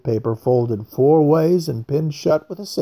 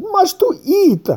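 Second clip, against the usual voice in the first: eager outburst; 5 dB louder, slower than usual. A narrator's reading. Craxy blurts out first.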